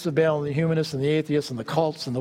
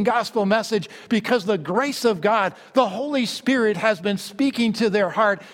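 raised, fervent pulpit voice; preaching; 5 semitones higher than usual. Acted. Preacher proclaiming the gospel to his congregation, fervent raised voice.

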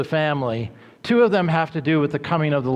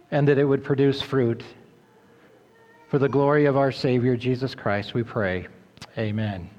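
prayer; 2 semitones lower than usual. Acted. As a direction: pray humbly over the congregation. Pastor leads opening prayer.

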